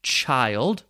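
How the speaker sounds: put-on "child" said with a long I, as in "wild". Shown as a demonstration. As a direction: model the long I vowel in "child". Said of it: "that is a long I vowel sound".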